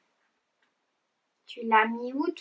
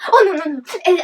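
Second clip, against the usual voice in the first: ordinary talk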